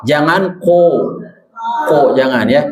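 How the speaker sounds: ordinary delivery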